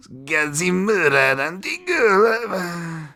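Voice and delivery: high, slow, drawn-out fighter intro; ring announcer. Pretend. Host calling the fighter's name like a ring announcer.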